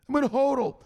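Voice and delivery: high-pitched pupil's answer; dutiful follower. Acted. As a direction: play the follower reciting on demand. Voices follower answering his drill.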